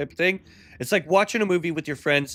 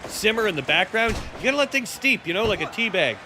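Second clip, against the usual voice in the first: excited commentary bursting higher at the upset; wrestling play-by-play; 5 semitones higher than usual. Pretend. Commentator calling a sudden three count, excited play-by-play. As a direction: call the match like a ringside commentator.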